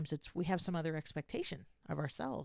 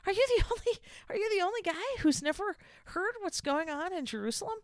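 much higher, louder, disbelieving voice; can you believe this guy, pitched way up; incredulous disciples; 5 dB louder than usual. Acted. Disciples astonished at the stranger.

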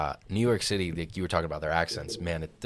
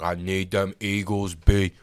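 New York bettor; 4 dB louder, slower than usual. Retold or imitated. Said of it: "He's like".

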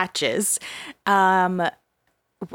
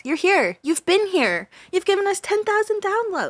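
rising higher and higher, gushing; excited; 10 semitones higher than usual. Real, not acted. Host gushing to loyal listeners, building excitement. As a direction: build it up with mounting glee.

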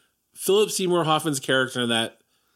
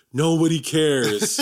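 slow, bored put-down; dismissive; slower than usual. Retold or imitated. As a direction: brush it off dryly. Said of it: "some rando in my class was like".

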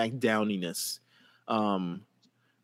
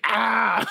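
high mock yell; 10 semitones higher, 4 dB louder than usual. Drunk Jeff yelling.

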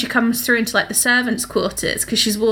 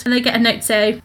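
ordinary talk, nothing marked

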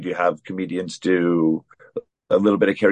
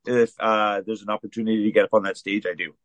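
ordinary talk

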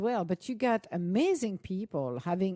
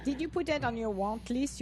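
usual speaking voice

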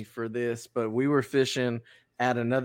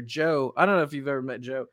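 ordinary talk

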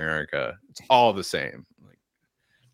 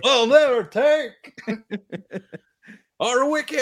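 much higher, louder, slow mock Scottish rallying cry breaking into laughter; bad Scottish accent; 5 dB louder, slower than usual. Host joining the Braveheart bit, laughing bad Scottish accent. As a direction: bellow it like a laughing Braveheart with wickets. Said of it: "In a really bad accent".

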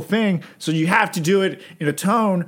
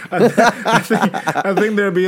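laughing; 5 semitones higher, 5 dB louder than usual. Real, not acted. Host laughing while stammering.

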